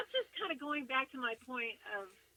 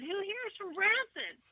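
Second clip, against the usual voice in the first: high, raised call out to a festival crowd; yelling to the crowd; 9 semitones higher than usual. Retold or imitated. Calling out to a festival crowd, finding someone from Branson, raised yell. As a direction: call out over the crowd, asking. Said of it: "So I yelled to the crowd".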